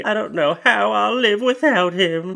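high, loud mock heartbreak; hammed-up lovesick whine; melodramatic girlfriend. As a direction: wail it like a soap heroine.

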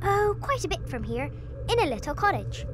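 put-on innocent little girl. A narrator's reading. Girl answers wolf.

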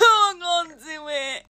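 laughing, half-crying whine; a wailing groan of defeat; mock despair. Real, not acted. Schoolgirl wails to her viewers while getting ready.

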